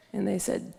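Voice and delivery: ordinary talk, nothing marked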